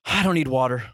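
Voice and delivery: low, subdued mumble; acted woozy refusal; weak refusal. Retold weak refusal after fainting.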